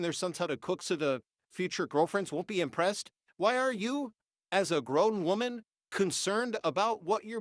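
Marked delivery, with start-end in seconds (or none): none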